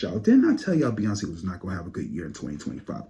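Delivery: ordinary talk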